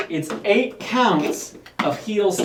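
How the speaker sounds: normal talking voice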